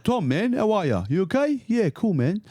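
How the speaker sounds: breezy Greek Australian bloke; Greek Australian accent. Retold or imitated. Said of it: "Greek is like".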